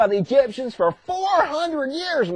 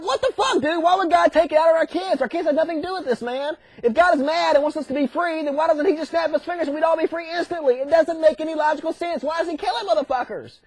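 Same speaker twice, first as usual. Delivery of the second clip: higher-pitched, aggrieved whining protest; mock indignant complaint; 5 semitones higher than usual. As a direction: plead in hammed-up disbelief. People protest the killing.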